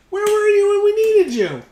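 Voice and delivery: high squeaky put-on scold; mock scolding voice. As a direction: scold him in a silly voice. Scolding a late ally card.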